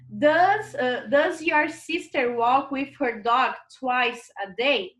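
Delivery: slow, careful English read-aloud; slow classroom reading of the English question; slow model reading. Shown as a demonstration. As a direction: spell out each word slowly for learners. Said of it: "teacher reads exercise sentence aloud".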